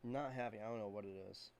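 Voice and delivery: hoarse, losing your voice; raspy whisper. Real, not acted. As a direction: voice fading to a rasp. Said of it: "I'm losing my voice today".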